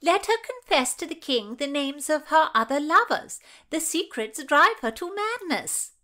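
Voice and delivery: high, loud, twanging insistence; insistent. A narrator's reading. Madge urges Margaret to let Anne confess, insistent. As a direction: trumpet the plea high and loud. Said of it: "Madge insisted".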